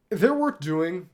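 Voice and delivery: usual speaking voice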